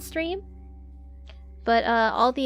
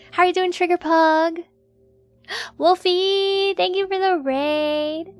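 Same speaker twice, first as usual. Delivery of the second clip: excited greeting; 6 semitones higher, 4 dB louder than usual. Real, not acted.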